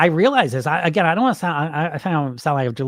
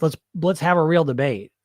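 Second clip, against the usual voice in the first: ordinary talk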